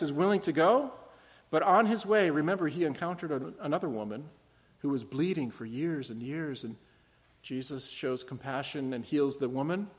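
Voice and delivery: normal talking voice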